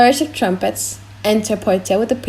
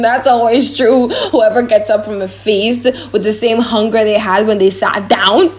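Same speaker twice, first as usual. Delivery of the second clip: ordinary talk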